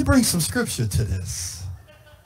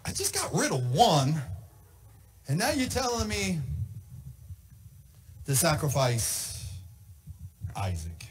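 lower, softer, slowing to a stunned hush; a hint of a shocked father trailing off; 1 stunned fading; 6 semitones lower, 11 dB quieter than usual. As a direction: let the name die in disbelief.

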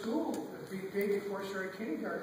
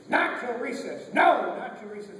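stern teacher; 3 semitones higher, 8 dB louder than usual. Acted. Teacher refusing bathroom break, sternly loud. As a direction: come down hard on each word.

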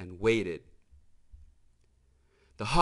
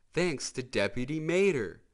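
ordinary delivery, nothing marked

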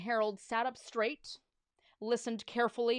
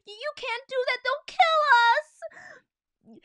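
high, loud, dragged-out panic; panicked pleading; 12 dB louder, slower than usual. Acted. George begs the principal, panicked high wail. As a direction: wail the plea in terror. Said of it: "cried George".

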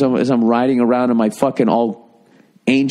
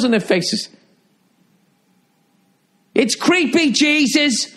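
high-pitched, strained mock outburst; exasperated rant; 13 semitones higher than usual. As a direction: rail at a creepy crowd, voice pitched way up. Acted rant at smiling heaven crowd.

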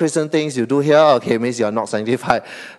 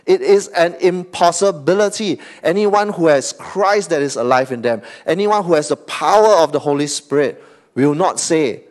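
ordinary delivery

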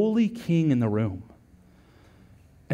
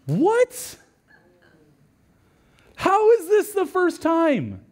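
higher, slow disbelieving question; preacher feigning shock at the story, higher; mock astonishment; 11 semitones higher, much slower than usual.

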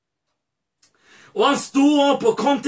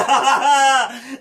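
overjoyed laugh. Acted. Father seeing his son return, overjoyed laugh. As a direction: laugh bursting out in joy.

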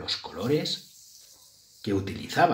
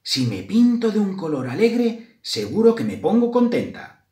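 higher-pitched storybook mouse voice; hopeful mouse; 10 semitones higher, 4 dB louder than usual. A narrator's reading. Mouse Grisela resolves to paint herself a happy colour, hopeful. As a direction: lift it lightly into a little mouse's voice.

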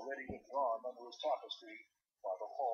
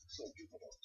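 ordinary delivery

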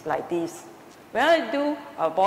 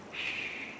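long, gentle "shh"; soft shushing; 11 dB quieter than usual. Shown as a demonstration. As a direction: softly hush the breath, drawn out. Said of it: "you will just say".

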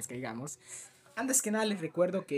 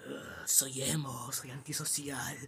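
lower, slow and much softer gloomy mumble; mock emo; 3 semitones lower, 6 dB quieter, slower than usual. Mocking Enar as a gloomy emo, low and slow. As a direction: sulk it out like a moody emo kid. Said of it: "está ahí en la oscuridad".

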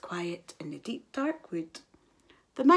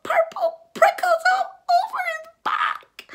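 very high, louder, trembling squeal; terrified mouse; 12 semitones higher, 8 dB louder than usual. A narrator's reading. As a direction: shriek in growing horror. Mouse sees real Gruffalo.

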